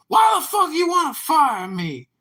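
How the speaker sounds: high, loud mock outrage; angry fired guy. At the apology meeting, the guy's loud high outraged voice. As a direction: squeak it out like the indignant fired guy.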